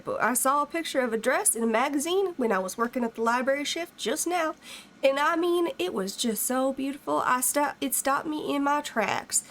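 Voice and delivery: reading a fan letter in a lightly put-on rural American country accent; mild rural American country twang. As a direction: play up a folksy rural American country accent a little, pitch nudged up. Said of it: "I'm stuck in this country accent".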